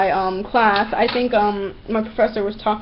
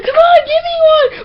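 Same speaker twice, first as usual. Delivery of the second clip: put-on very high, loud childish demand; over-the-top imitated pleading; mock tantrum begging; 7 dB louder than usual. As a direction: screech it like a whining child.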